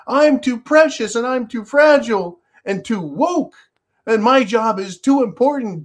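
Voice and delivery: high, loud, whining plea; mock crying, begging not to be fired; whiny mock employee. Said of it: "Can you imagine it?".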